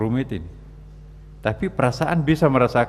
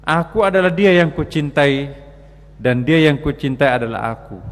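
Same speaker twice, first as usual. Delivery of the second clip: loud, raised poetic declamation; declaimed mystic verse; 3 semitones higher, 4 dB louder than usual. Acted. Preacher reciting a lover's verse to listeners, loud declamation. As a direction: proclaim the verse with force, opening high.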